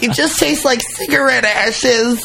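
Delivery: high put-on complaining kid; whiny teen voice. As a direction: whine it up high like a disgusted teenager. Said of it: "Scout's like".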